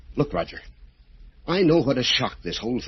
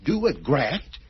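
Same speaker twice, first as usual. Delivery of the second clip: higher, pitch climbing in disbelief; can't believe what he's hearing; incredulous; 10 semitones higher than usual. Acted. Surgeon reacts to Roger's demand with rising disbelief.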